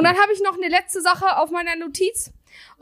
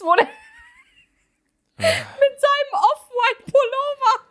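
laughing; 11 semitones higher than usual. Real, not acted. Laughing while describing photo.

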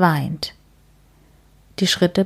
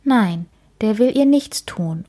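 ordinary delivery

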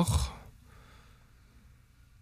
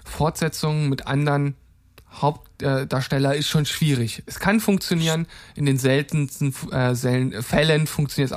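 ordinary delivery

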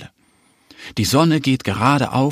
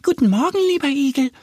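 much higher, cheery duckling greeting; duck greeting; faster than usual. Acted. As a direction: chirp a hello like a little duck. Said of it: "begrüßt Annie ihn".

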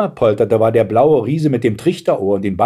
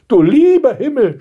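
high-pitched exasperated grandmother; groaning; 14 semitones higher, 5 dB louder than usual. A narrator's reading. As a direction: groan in dismay. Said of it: "stöhnte die Großmutter". (stöhnende Großmutter, hoch)

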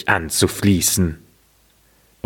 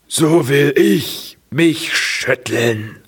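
raised, slow little tree voice; 5 semitones higher, slower than usual. Acted. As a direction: declare it like a tree about to shake. Tree decides to shake.

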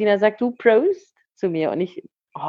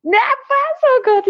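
a thrilled, high-pitched "what?"; delighted surprise; 4 dB louder, slower than usual. Real, not acted. Podcaster thrilled by her friend's answer, squealing in surprise. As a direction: squeal of delight, far higher. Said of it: "ich feier dich so hart".

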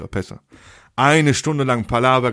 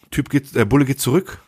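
usual speaking voice

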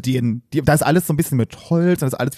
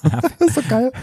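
high, laughing burst of delight; real amused laughter through the words; 11 semitones higher than usual. Real, not acted. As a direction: crack up at the slip. Laughing at the friend's word slip.

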